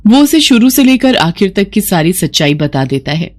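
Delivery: ordinary delivery, nothing marked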